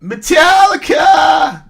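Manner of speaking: slow, high drawn-out shout. Acted. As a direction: belt out the name. Shouts viewer name.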